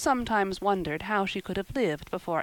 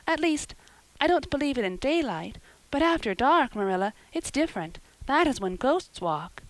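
put-on hesitant, earnest girl; 8 semitones higher, 4 dB louder than usual. A narrator's reading. Anne defending her belief in ghosts, earnest.

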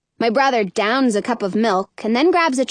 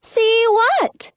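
high-pitched, oblivious mother; queen's question; 11 semitones higher than usual. A narrator's reading. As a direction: ask it lightly in a high voice. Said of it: "the queen asks".

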